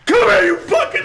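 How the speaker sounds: high loud yell at the creature; furious shout. Real, not acted. Gamer taunting the monster, furious shout. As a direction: bellow it at the thing.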